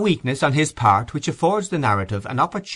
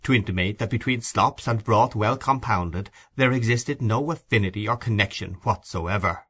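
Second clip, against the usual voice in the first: plain reading voice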